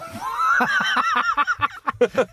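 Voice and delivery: a huge, high, shrieking burst of laughter; howling laugh. Real, not acted. Host hears Jim say nine nines is eighty-six, howling laugh. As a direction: explode into helpless laughter.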